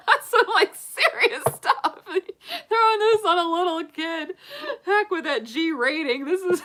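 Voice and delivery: high, loud, talking through laughter; real laughing fit; helpless laughter. Real, not acted. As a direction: squeeze the words out while cracking up. Cracking up over childhood movie trauma.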